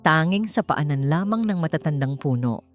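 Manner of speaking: usual speaking voice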